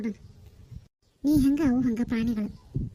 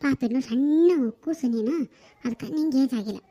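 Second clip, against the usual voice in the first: usual speaking voice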